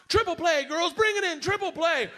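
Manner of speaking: high, excited coach call; upbeat coach summoning players in. Acted. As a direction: holler the play to the team. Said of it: "Coach is like".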